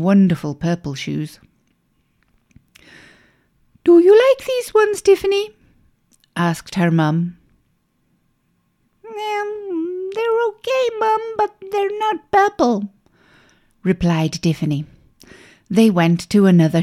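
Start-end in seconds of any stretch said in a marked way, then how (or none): 3.8-5.5 mother voice. Acted. Mother asking in shop. 9.0-12.9 put-on high, hesitant child voice; unimpressed little girl, hesitating.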